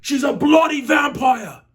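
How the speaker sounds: put-on shouted Australian accent, high. Reviewer blurting the film's twist in a high shouted Australian accent. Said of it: "I went Australian accent with that".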